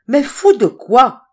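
high, loud, drawn-out demand; utterly exasperated. A narrator's reading. Patrice asks what the man is mad about, exasperated. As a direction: demand it slowly, at the end of patience. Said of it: "interrogeait Patrice au comble de l'exaspération".

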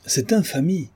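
high-pitched cry of outrage; indignant exclamation. A narrator's reading. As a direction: exclaim it, scandalised. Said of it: "s'écria la comtesse". (exclamation indignée de comtesse)

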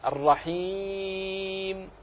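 الرحيم with a slow, full long ee; الرحيم recited with a full madd. Shown as a demonstration. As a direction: draw out the ee in الرحيم. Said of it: "وبين قولك مثلًا".

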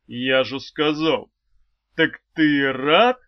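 slow, loud, weighty demand; insistent. A narrator's reading. Swordsman demanding an answer from his pupil, insistent. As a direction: press hard on a drawn-out «рад».

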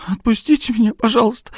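high, sobbing plea for release. Acted. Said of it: "рыдая".

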